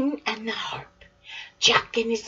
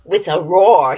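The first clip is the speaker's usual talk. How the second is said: usual speaking voice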